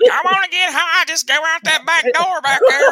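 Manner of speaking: very high, loud squealing impression; hammed-up falsetto holler, laughing at the edges; Joey Gross. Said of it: "Joey Gross come back in".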